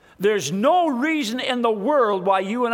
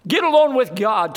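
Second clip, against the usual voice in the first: ordinary talk, nothing marked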